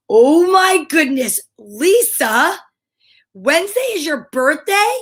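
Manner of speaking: high, drawn-out amazement; big delighted surprise. Real, not acted. Learns of viewer's birthday.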